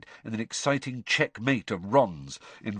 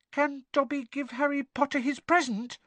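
much higher squeaky elf voice, hesitant; tentative squeak. A narrator's reading. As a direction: ask shyly like a timid house-elf. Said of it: "he squeaked tentatively".

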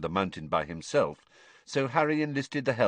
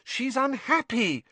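put-on higher, slow, exasperated girl's voice; exasperated Hermione; slower than usual. Hermione exasperated at the elves hiding Winky. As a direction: let the frustration drag the words.

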